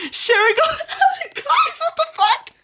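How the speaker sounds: very high, drawn-out laughing squeal; shrieking with laughter. Real, not acted. Streamer asking viewers about absurd tags, shrieking with laughter. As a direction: squeal to viewers in hysterical disbelief.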